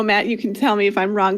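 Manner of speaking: ordinary talk, nothing marked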